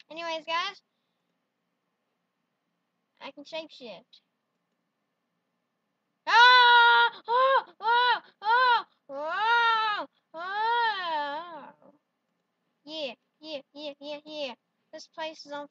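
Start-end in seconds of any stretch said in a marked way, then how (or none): 6.2-11.9 loud high screams fading down; mock agonized wails; screaming. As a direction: scream in pain, then wear out. Screaming while on fire.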